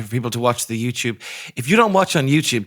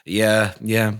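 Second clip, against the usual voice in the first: ordinary talk, nothing marked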